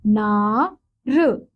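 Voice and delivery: अ ना र very slow, syllable by syllable; slow sounding out. Shown as a demonstration. Teacher showing children how to sound the word. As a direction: stretch out अनार piece by piece. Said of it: "तो ये क्या हो जाएगा?".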